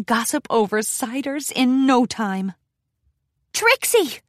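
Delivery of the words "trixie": high call across the street. A narrator's reading. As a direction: call out her name from behind. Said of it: "called out from behind her".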